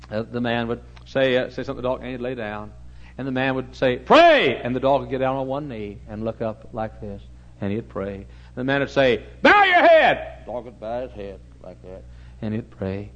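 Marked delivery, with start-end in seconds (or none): 4.0-4.6 loud, high command to a dog; barked command. Retold or imitated. Man commands performing dog. 9.4-10.4 high shouted command to a dog; loud command. Retold or imitated. Man commands performing dog.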